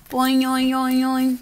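bouncy spring noises; boing sound effect. Acted. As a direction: bounce it like a jack in the box. Jack in the box noise.

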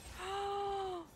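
sharp high gasp; startled gasp. Real, not acted. Gamer gasping as the boss attacks. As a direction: gasp in sudden fright.

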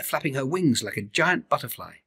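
plain reading voice

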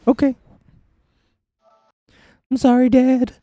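high, loud mock apology to dad; hammed-up child apologizing; scolded son act.

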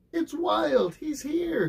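high, joyful cry of surprise; excited cry. A narrator's reading. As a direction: burst out with delight. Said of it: "he cried".

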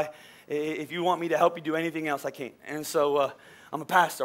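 ordinary delivery

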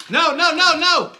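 loud, high, rapid nos; urgent no. Real, not acted. Dad calls out loud and high to stop the filming. Dad stops the camera in alarm.

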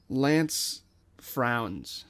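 plain reading voice

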